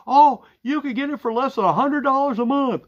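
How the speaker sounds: high-pitched, hammed-up advert voice; mock TV pitchman. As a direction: parody an over-eager TV deal pitch. Mocking TV phone deal adverts.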